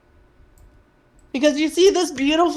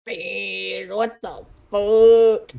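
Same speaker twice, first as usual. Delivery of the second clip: slow, drawn-out sick croak; sick sleepy voice; 3 semitones lower, much slower than usual. Retold or imitated. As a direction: stretch it out groggy and unwell. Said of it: "I lost my sleepy voice, my sick sleepy voice".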